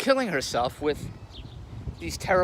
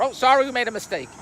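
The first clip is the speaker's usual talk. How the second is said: higher, louder mocking apology; 7 semitones higher, 5 dB louder than usual. Comic mocks newspaper owning up to errors, mock apology. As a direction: parody a careless paper saying sorry.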